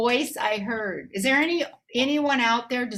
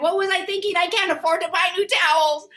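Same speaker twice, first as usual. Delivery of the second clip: higher, louder self-scolding; mock exasperated outburst; 7 semitones higher, 5 dB louder, faster than usual. Herself regretting giving away the towels, exasperated. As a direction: kick yourself out loud.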